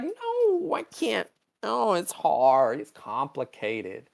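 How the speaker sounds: put-on high start, slow childish protest; mock whining. Chapel refusing to confess her crush, mock whining. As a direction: whine it, stretching the words. Acted reluctant whine to friends.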